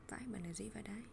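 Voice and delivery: breathy unvoiced aside, amused; whispered aside. Real, not acted. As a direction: whisper it to yourself. Aside to herself. (thì thầm một mình)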